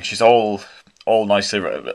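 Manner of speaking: normal talking voice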